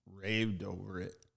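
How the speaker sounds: ordinary talk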